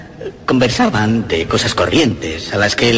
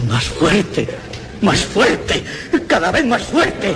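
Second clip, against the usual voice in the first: más fuerte repeated, each one higher in pitch; building panic; 7 semitones higher, much slower than usual. Acted. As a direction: ratchet up the tension word by word. El ruido crece, voz escalando. (más fuerte cada vez más agudo y angustiado)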